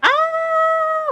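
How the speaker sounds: normal talking voice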